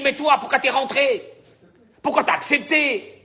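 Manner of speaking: high, indignant questioning; acted annoyance. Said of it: "qu'il aurait dû, entre guillemets, s'énerver". (reproche joué, questions indignées et aiguës)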